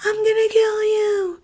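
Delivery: put-on very high, shrill little-kid threat; five-year-old brother screaming at his sister; mock kid yelling. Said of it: "he starts yelling".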